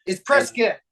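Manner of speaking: short "-kit" ending on "Prescott"; local pronunciation. Shown as a demonstration. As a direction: correct them with "Prescott" rhyming with biscuit. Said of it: "It's Prescott like biscuit".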